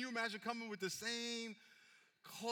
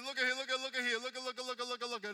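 higher, louder, pressing repetition; insistent look at; 5 semitones higher, 5 dB louder, faster than usual. Acted. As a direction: grab their attention with urgent insistence. Jesus voiced, insistent, loud.

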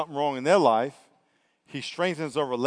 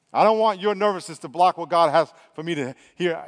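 ordinary talk, nothing marked

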